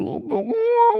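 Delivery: high wailing "oh, oh, oh"; imitated child crying. Parent imitating her crying child, high mock wail.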